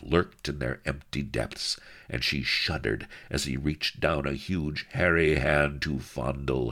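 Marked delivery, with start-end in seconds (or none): none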